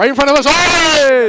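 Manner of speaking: very loud, high yell of surprise; excited shout. Real, not acted. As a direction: shout as the foul happens in front of you. Foul right in front of them.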